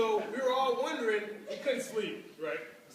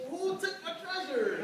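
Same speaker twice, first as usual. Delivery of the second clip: slow, higher leprechaun voice; 6 semitones higher than usual. Acted. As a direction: play the leprechaun demanding his gold. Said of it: "with the leprechaun voice".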